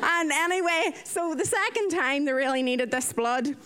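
ordinary talk, nothing marked

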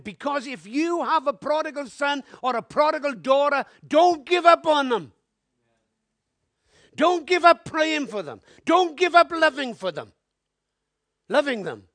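loud, rising appeal from the pulpit; preaching. Acted. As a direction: plead with the congregation at full force.

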